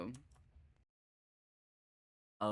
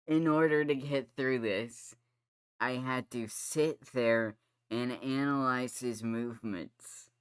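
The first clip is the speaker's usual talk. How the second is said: loud, heated, exasperated rant; rage rant; 3 semitones higher, 6 dB louder than usual. Real, not acted. Gamer venting about counting his steps, heated and loud. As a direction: rant loudly, fed up with the game. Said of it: "That was the rage portion".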